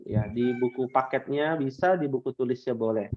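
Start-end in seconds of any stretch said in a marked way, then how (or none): none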